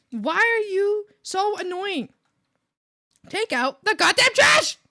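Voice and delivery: slow, high, loud exasperation; drawn-out furious shout; re-enacted yelling. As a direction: stretch each word into an angry yell. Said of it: "I kept yelling".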